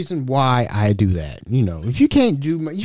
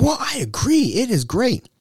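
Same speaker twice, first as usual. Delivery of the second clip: telemarketer voice; 10 semitones higher, 3 dB quieter than usual. Retold or imitated. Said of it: "It's like".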